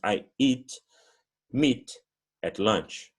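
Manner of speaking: meat at lunch, each word spelled out slowly; slow model phrase. Shown as a demonstration. Teacher slows down so students catch meat at lunch. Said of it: "teacher models English slowly".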